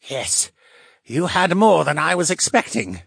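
usual speaking voice

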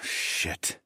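soft, unvoiced expletive; muted curse. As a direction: curse under your breath. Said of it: "I cursed".

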